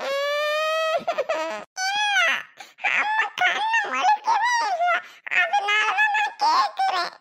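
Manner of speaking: put-on very high whining child voice; tearful child. Child explaining question to scolding aunt, tearful protest. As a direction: protest in a squeaky, tearful whine.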